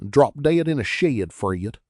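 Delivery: cocky rhyming put-down; smart retort. A narrator's reading. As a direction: fire it back cheekily. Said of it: "Teddy returned smartly".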